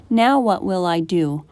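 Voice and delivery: ordinary delivery, nothing marked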